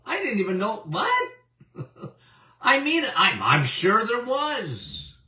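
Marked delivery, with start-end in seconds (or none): 0.9-1.3 incredulous what. Real, not acted. Incredulous reaction.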